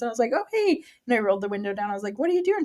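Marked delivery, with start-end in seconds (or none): 0.3-0.8 surprised greeting. Retold or imitated. Calling to child from car. 2.2-2.6 high curious call. Retold or imitated. Calling to child from car.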